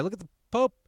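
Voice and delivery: gushing over a viral clip; mock hype narration. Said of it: "mock viral video narration".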